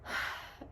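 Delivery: long unvoiced breath out; big relieved sigh; deep relieved exhale. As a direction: let all the air go. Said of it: "I was like".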